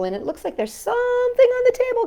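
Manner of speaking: plain reading voice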